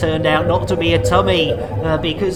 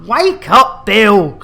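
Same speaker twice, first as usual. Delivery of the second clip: calling out loud; 7 dB louder, slower than usual. Acted. Waking sleeping crewmate.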